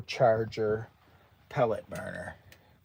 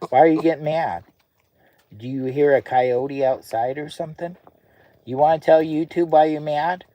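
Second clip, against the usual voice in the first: higher and louder, teasing the dog; loud teasing dog talk; 3 semitones higher, 8 dB louder than usual. Real, not acted. Owner teasing his mad dog Lou, loud and playful. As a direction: call out playfully to the grumpy dog.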